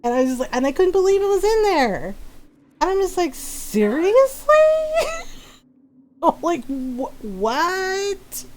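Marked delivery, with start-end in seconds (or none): 3.3-7.1 high, drawn-out incredulous question; exaggerated rising incredulity; mock disbelief. Acting out her disbelief. 7.2-8.5 high, drawn-out, stunned question; exaggerated incredulous squeal; mock disbelief. Acting out her disbelief.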